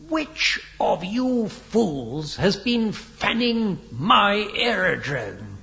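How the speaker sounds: slow, high-pitched outraged bark; angry bark. A narrator's reading. Irate Major confronts the pilots in the mess, barking. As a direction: drag out each word in outrage. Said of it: "barked the Major".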